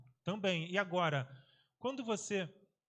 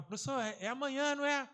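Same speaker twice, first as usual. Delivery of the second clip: put-on higher, pleading kid question; eager pupils; 7 semitones higher than usual. Teacher imitating students asking about the next class, higher. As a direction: nag like keen students. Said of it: "eles ficavam".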